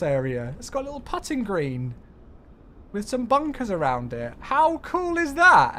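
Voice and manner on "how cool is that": high, loud and drawn out in delight. Real, not acted. Streamer to viewers about scenery, thrilled exclamation. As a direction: gush over the little golf course, slowly. Delighted by tiny golf course.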